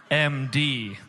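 usual speaking voice

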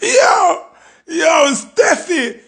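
high, drawn-out cry of excitement. Real, not acted. Reacting to Steffi's diss, crying out high. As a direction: call out to her in thrill.

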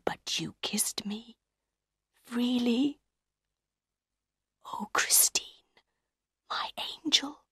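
hushed, halting confession; whispered. A narrator's reading. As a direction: whisper it in wonder and old hurt. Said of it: "he whispered".

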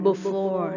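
before said slowly as bo-for; another way to say it. Shown as a demonstration. Teacher showing the class another way to say before. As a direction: draw out before as bo-for. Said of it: "บางคนก็จะออกเสียงว่า before".

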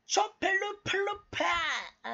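唇で高くペチャペチャ鳴らす; わざと気持ち悪い口の音を立てる; 不快なリップ音. Shown as a demonstration. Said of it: "世界一不快なリップ音やります". (世界一不快そうな甲高いリップ音)

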